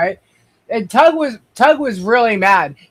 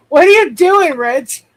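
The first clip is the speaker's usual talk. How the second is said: laughing exclamation; 5 semitones higher, 6 dB louder than usual. Real, not acted. Laughing at co-host's antics.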